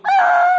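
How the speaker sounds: high, very loud "aah"; big comic scream of alarm; mock panicked yell. Driver's panic as door closes.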